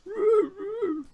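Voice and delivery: high, silly hippo call; a friendly hippo calling out, pitched high; hippo noise. Acted. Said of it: "And the hippo goes".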